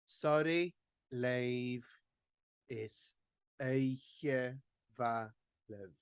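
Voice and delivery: each Gaelic word spoken slowly and clearly for learners; Gaelic lyric read slowly. Shown as a demonstration. Tutor modelling Gaelic chorus words for online singers, slow and careful. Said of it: "those two words there are pronoun-, uh, spelt the same".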